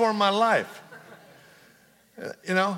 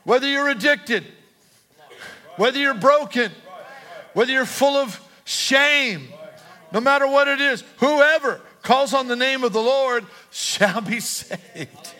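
loud, high, slow preaching build; full-force preaching; 7 semitones higher, 4 dB louder than usual. Acted. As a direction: preach it out with rising fire. Preaching the promise.

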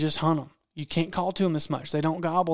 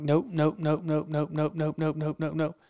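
repeated hushed refusal; 3 dB quieter than usual. Retold or imitated. Guide holding off the shooter.

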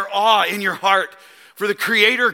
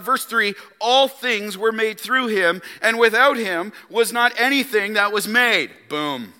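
ordinary talk, nothing marked

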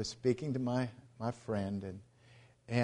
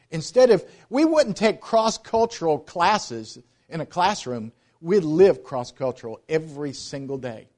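fervent preaching; 6 semitones higher, 7 dB louder than usual. Acted. Missionary pitch to church.

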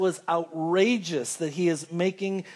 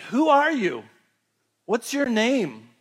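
Moses asking; 5 semitones higher, 3 dB louder than usual. Acted. Moses questions God at bush.

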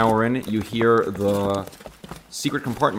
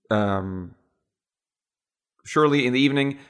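ordinary talk

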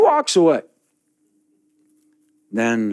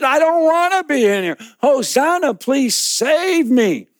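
desperate plea; 13 semitones higher, 4 dB louder than usual. Acted. Man in the pit begging Confucius to save him, high desperate plea. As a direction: beg for your life. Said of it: "trapped man pleads again".

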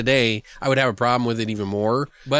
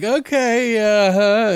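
wary uh-huh; 9 semitones higher, 5 dB louder, much slower than usual. Retold or imitated. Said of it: "they're like".

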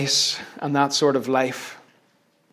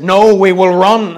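louder cry from the ranks; scrawny Scottish soldier; 3 semitones higher, 8 dB louder than usual. Retold or imitated. Scrawny Scottish guy answers Wallace, called out. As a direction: call back as a wee Scottish soldier. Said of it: "this scrawny wee Scottish guy says".

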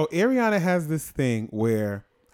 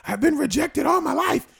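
hammed-up desperate pleading, higher and louder; mock pleading; 8 semitones higher, 7 dB louder than usual. Husband mock-begging wife not to reject him, hammed-up pleading. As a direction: beg her not to throw you away, half joking. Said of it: "I always tell her, I say".

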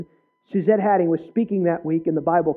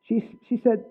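ordinary delivery

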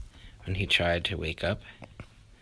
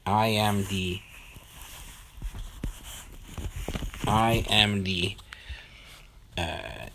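Alexei the pilot; 2 semitones higher, 7 dB louder than usual. Acted. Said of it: "the voice on the other side said".

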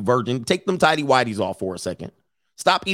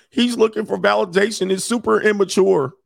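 higher whiny mock voice of a scold; mocking the virgin commenter; 8 semitones higher than usual. Mocking a commenter who calls him immature. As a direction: sneer it like a preachy commenter. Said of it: "he's gonna be like".

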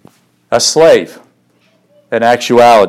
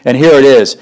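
ordinary talk

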